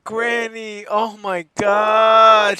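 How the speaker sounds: loud, stunned cry at the sight; shocked shout. Real, not acted. Finding Granny bloodied, shouts in shock. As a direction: yell out in horror on finding her.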